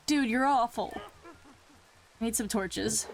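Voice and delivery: ordinary talk, nothing marked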